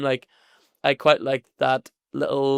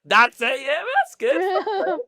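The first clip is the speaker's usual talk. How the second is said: mock high praise; 4 dB louder, much slower than usual. Hammed praise, very high, slow.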